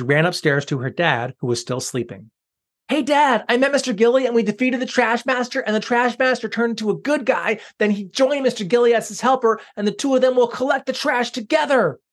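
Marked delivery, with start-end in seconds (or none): 2.9-12.0 put-on high, fast, eager young girl; bursting to tell her dad; excited girl voice. Sarah telling sleeping dad.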